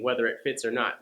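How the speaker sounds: ordinary talk, nothing marked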